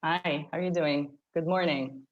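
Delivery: ordinary delivery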